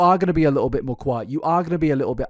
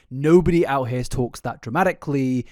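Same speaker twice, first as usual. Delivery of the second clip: ordinary delivery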